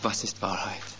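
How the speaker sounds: low, soft, dismissive cynical question; cynical remark. Retold or imitated. Pilate turning his back on Jesus, low and cynical. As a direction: toss off „Was ist Wahrheit?“ with a cynical shrug in the voice. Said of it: "mit dem zynischen Kommentar".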